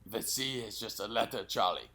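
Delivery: put-on German accent retry. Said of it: "Like that?".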